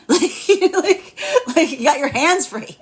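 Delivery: high, giggling laugh. Real, not acted. Laughing as she jokes about partnership. Laughs at her own joke.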